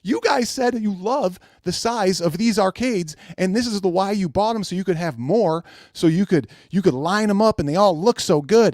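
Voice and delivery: high, exasperated disbelief; incredulous rant. Retold or imitated. Calling out fans for switching sides, high exasperation. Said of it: "I'm going".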